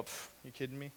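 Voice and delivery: dismissive muttered mockery; quiet contemptuous jeer; scoffing aside. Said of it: "walk away and go".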